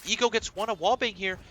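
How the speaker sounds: loud, high hype play-by-play; esports caster. Acted. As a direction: call every kill with rising excitement. Live play-by-play of a clutch round.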